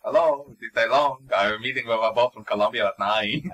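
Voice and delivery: loud put-on Colombian accent; mock Colombian businessman voice. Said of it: "gonna relate to my Colombian one".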